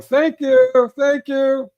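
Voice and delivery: very high, loud goofy thank you; mock cheery thanks. Driver cheerfully thanking warning strangers, high goofy. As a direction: wave them off like a happy drunk. Drunk-sounding cheerful reply to warners.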